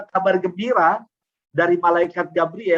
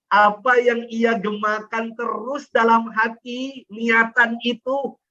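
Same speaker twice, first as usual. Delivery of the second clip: slow emphatic; 5 semitones higher, slower than usual. Acted. Slow emphatic sermon build.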